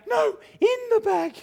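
very high, loud correction; panicked wife imitation. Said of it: "because she went".

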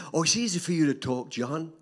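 ordinary talk, nothing marked

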